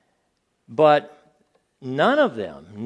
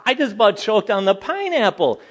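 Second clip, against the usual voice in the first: laughing; 7 semitones higher, 5 dB louder than usual. Real, not acted. Laughing while retelling the shock.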